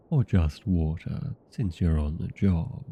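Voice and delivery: usual speaking voice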